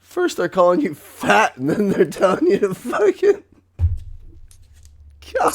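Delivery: a hammed-up indignant rant. Real, not acted. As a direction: rant it like you can't believe it. Ranting about doctors.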